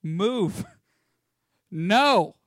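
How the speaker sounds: hammed-up bossy guard shooing a loiterer; mock security guard. A guard tells loiterers to move, hammed-up bossy voice. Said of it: "They're just like".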